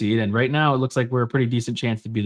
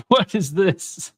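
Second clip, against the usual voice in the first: laughing; 5 semitones higher than usual. Real, not acted. Laughing at partner's odd behavior.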